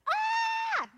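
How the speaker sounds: loud, very high freak-out shriek; panicked scream. Acted. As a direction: scream in hammed-up terror. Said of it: "he's freaking out".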